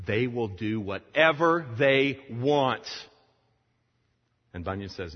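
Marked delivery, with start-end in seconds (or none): 0.1-2.9 slow, loud, higher acted alarm; indignant objection. Church leaders objecting to Bunyan, loud slow indignation. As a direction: hammer out WHATEVER THEY WANT in alarm.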